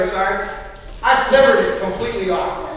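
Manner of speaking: ordinary talk, nothing marked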